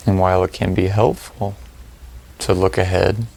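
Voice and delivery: calm, spacious delivery, letting each phrase settle; guided meditation. Real, not acted. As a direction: lead them through the breathing, unhurried and even.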